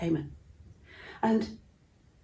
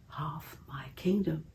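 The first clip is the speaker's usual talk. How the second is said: whispered offer; 2 semitones lower, 7 dB quieter, slower than usual. Acted. King's generous offer to Esther.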